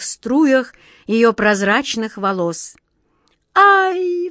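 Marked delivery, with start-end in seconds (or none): 3.5-4.3 high, loud yelp of pain; startled cry. A narrator's reading. Queen as her hair gets yanked, crying out. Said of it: "вскрикнула королева-ветреница".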